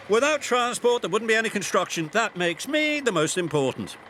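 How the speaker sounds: boasting. A narrator's reading. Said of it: "boasted Nelson".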